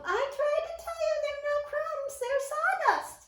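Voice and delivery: high anxious mouse. A narrator's reading. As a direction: urge him, worried. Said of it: "said Chris".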